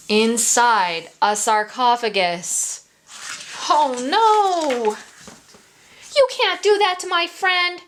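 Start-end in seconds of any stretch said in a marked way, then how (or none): 3.7-7.8 shouted. A narrator's reading. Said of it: "shouted Pete".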